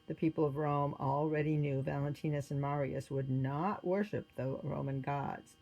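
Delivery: ordinary delivery, nothing marked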